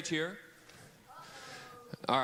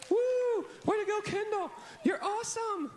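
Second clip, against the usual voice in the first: much higher, louder cheering; hype cheer; 4 dB louder than usual. Real, not acted. Host cheering Kendall onto the stage.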